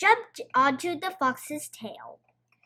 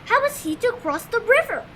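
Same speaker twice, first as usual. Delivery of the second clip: plain reading voice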